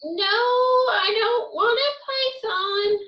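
put-on high, drawn-out child's refusal; whining protest. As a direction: wail the refusal like a stubborn child. Child rejects the python.

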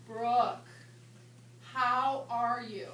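very slow, loud and over-clear; exaggerated slow enunciation; slow, enunciated English. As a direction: stretch each word for a non-English speaker. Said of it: "attempt to slow down my English".

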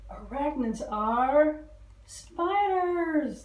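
usual speaking voice

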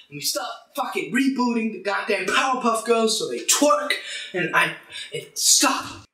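loud, furious rant, slowing at the end; ranting shout. Real, not acted. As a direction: let the anger spill over into a yell. Ranting against reboots, boiling over.

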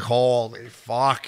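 very slow, stretched-out swearing; drawn-out exasperation. Retold or imitated. Coach asked about coaching a young player, drawn-out mock exasperation. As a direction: stretch each word in mock weariness. Retelling coach's reaction to the question.

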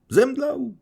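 very high, loud startled shout. Acted. Everyone crying out as Gania faints, startled shout. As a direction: cry out in shock. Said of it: "krzyknęli wszyscy".

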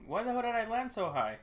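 exasperated. Real, not acted. Bad landing in the game.

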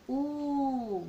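exaggerated rounded "U", lips pushed forward; drawn-out U. Shown as a demonstration. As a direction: show the mouth shape on "U". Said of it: "Mire mi boquita". ("U" exagerada con labios redondeados)

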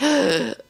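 sharp mock gasp for air, high. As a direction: act out a breathless gasp mid-run. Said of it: "literally gasping for air".

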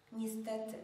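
usual speaking voice

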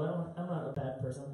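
normal talking voice

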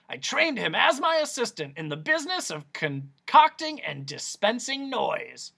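high pompous doctor; exasperated doctor. Acted. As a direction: finish the point with effort. Doctor finishing.

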